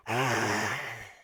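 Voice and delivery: drawn-out amateur monster growl; empty your lungs in one generic growl; poor monster growl. Shown as a demonstration. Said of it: "really, really poor".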